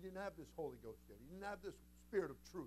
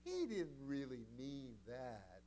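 lightly voiced, slow doubting follower; slower than usual. Preacher imitating followers who doubt Jesus, lightly mocking excuse. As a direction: drawl out the excuse half-heartedly. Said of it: "now you can imagine some of them".

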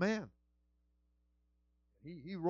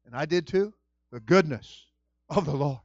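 normal talking voice

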